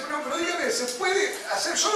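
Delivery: high mock-innocent quiz voice; playful questioning. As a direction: ask it like a sly butcher testing the crowd. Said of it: "Yo decía".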